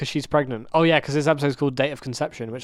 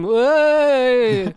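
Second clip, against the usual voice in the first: excited exclamation; 9 semitones higher, 5 dB louder than usual. Retold or imitated. Imitating Hurley's excited exclamation.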